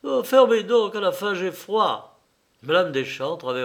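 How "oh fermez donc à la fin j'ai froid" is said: high, loud burst of exasperation; angry outburst. A narrator's reading. Son at table cries out to the servant, angrily. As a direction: snap angrily, pitch jumping up. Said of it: "il s'écria avec une colère dans la voix".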